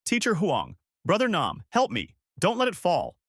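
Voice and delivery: understated scream for help; restrained scream. A narrator's reading.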